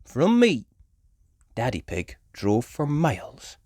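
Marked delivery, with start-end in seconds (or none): 0.1-0.6 put-on high, loud fan-girl rabbit; Miss Rabbit voice. A narrator's reading. Miss Rabbit gushing about her favourite actor, high and eager. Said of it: "said Miss Rabbit".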